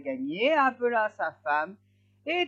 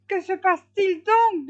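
high, loud, puzzled question; alarmed hedgehog wife asking; startled wife; 12 semitones higher, 9 dB louder than usual. A narrator's reading. Wife questions sudden order. (épouse étonnée, voix aiguë)

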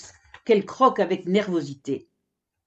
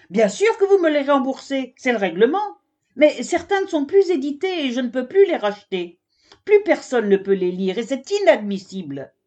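raised, louder scolding voice; exasperated scolding; 6 semitones higher, 4 dB louder than usual. Acted. Giraffe librarian scolds the camel who loses books, exasperated and louder. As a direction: scold him hard, losing patience.

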